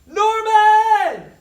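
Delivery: very high, loud call; frantic boy calling; cried out. A narrator's reading. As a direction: call out in alarm. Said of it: "cried Jared".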